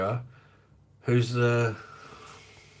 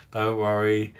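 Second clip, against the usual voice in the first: normal talking voice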